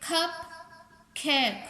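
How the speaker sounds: "कप", long gap, "केक"; split into syllables. Shown as a demonstration. Teacher counting two syllables, "कप. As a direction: say "कप केक" as two isolated beats. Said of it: "टू syllable".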